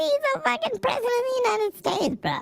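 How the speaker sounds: incredulous squeal. Acted. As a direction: squeal it in disbelief. Mocking exclamation.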